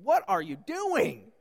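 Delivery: loud, drawn-out, incredulous question; disciples' exasperated demand. Acted. Disciples confronting Jesus, exasperated. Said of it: "going to Jesus and saying".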